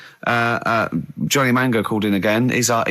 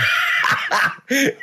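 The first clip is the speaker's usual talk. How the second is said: breathy laugh; 12 semitones higher than usual. Real, not acted. Laughs at Gallagher joke.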